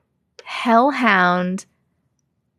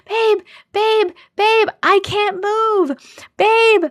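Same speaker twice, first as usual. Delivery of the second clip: high-pitched panicked cry for help, held back in volume; high, urgent mock scream for babe; 10 semitones higher, much slower than usual. A narrator's reading. Woman who can't move calling out to her sleeping boyfriend, mock screaming. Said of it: "started screaming at my husband".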